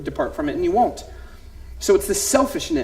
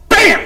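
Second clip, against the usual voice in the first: sudden loud high smack sound; loud bam; 13 semitones higher, 9 dB louder than usual. Retold or imitated. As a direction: punch the sound out. Son hits baby, sound effect.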